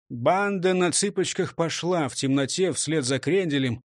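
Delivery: plain reading voice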